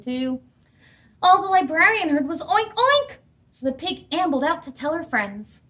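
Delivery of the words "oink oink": oinking pig. Acted. Librarian hears only pig sounds.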